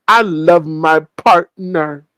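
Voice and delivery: slow, stretched baby-talk imitation; little kid voice. Host mimicking him on his partner, little-kid mockery. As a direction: say it like a little kid, dragging each word. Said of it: "like a little kid".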